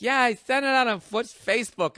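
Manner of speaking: high mock-excited woman's voice; excited partner. Partner telling her mom about the party, mock-excited. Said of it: "all excited about the party. And she's like".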